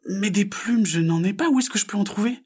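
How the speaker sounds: high, questioning frog voice; little frog. Acted. Frog asks where to find feathers, high puzzled voice.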